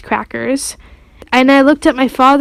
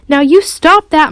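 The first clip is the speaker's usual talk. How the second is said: slow, louder, firm scolding; stern father's yell; 4 semitones higher, 5 dB louder than usual. A narrator's reading. Father to screaming toddler, stern yell. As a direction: put your foot down, slow and loud. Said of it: "my father yelled at Fudge".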